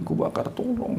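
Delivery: high soft unvoiced plea; imitated desperate cry; mock pleading. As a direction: beg like you are burning. Jinn begging while burned.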